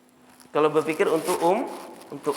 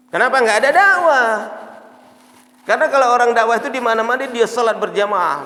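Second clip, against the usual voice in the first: forceful preaching; 7 semitones higher, 7 dB louder than usual. Real, not acted. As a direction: proclaim it loudly to the congregation. (ceramah lantang, menggebu-gebu)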